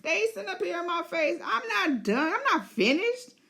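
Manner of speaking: high, laughing, defiant dragon voice; the stubborn dragon refusing to retreat; cocky beast laughing off orders. Pretend. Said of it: "And Sheep Stealer's like".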